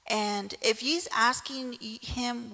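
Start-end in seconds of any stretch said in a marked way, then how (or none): none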